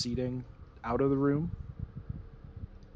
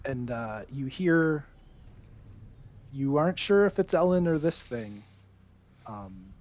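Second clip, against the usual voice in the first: normal talking voice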